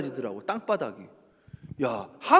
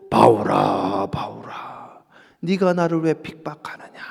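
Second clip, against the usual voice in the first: low hushed divine call, fading to a whisper; voice of God; 12 semitones lower, slower than usual. Acted. God rebuking Paul in a low, whispering voice.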